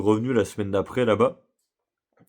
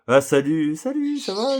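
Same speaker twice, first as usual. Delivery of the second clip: imitating the cheerful cashier; 8 semitones higher, slower than usual.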